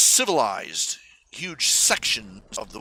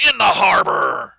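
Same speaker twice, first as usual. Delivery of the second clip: loud punchline retort; 7 semitones higher, 10 dB louder than usual. Retold or imitated. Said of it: "some guy replied".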